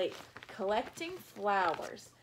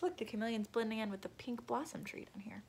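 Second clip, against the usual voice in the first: whispered aside; 7 dB quieter, faster than usual. Real, not acted. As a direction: point it out in a hush. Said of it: "whispered aside".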